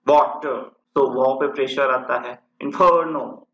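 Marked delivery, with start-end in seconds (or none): 0.1-0.7 "water" with pressure on the first syllable "वॉ"; British-style stress. Shown as a demonstration. Teacher demonstrating British pronunciation of water, stress on वॉ. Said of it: "तो उनके pronunciation ऐसे है". 2.6-3.5 "inferno" with the middle "फर" pressed and lengthened; British-style stress. Shown as a demonstration. Teacher demonstrating British pronunciation of inferno, stress on फर. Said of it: "फर पे pressure आता है".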